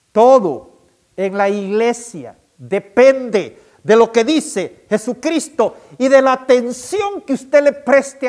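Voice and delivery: más despacio. Shown as a demonstration. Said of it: "se lo voy a repetir más despacio". (repetir más despacio, con fuerza)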